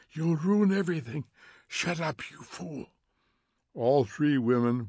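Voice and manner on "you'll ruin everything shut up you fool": scraping voice. A narrator's reading. Faber scolds Montag in his ear, scraping voice. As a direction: scrape at him, dropping away at the end. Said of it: "Faber's voice scraped away at him".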